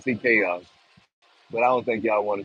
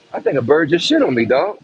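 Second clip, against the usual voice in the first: real shock; 5 semitones higher, 6 dB louder than usual. Real, not acted. Bird droppings land on him.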